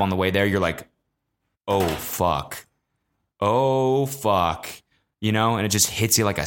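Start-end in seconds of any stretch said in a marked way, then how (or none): 1.7-4.7 low, drawn-out mock realization; dawning dread. Drinker feeling five shots hit in the cab, dawning dread. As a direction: let it sink in with mock dread. Drunkenness suddenly hits him in cab.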